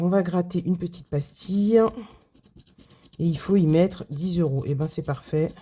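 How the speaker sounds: ordinary talk, nothing marked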